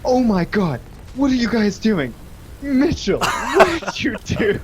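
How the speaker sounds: high, alarmed teacher voice; panicked teacher. Retold or imitated. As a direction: shriek up at the student, slowing at the end. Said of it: "Miss Monty comes out ... and goes".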